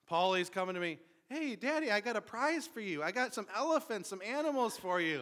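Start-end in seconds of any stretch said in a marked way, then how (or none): 1.3-5.2 put-on higher, bright child voice offering a gift; eager little boy. Little son brings toy animals to his father, eager child voice. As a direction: sound like a small son proud of his present.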